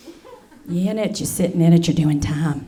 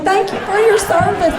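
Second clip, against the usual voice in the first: much higher, sugary thank-you; chirpy mock gratitude; 9 semitones higher than usual. Comic to troops, high chirpy pageant thanks. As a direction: play the clueless sweet visitor. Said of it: "And I'm like".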